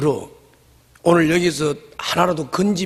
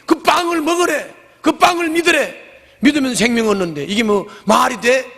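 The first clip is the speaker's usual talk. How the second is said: high, indignant scoffing at Jesus; grumbling crowd; 8 semitones higher than usual. Retold or imitated. Skeptical crowd grumbling about Jesus, high and scoffing. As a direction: scoff like doubters who know his father. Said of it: "이러면서 저들끼리 수군거렸다".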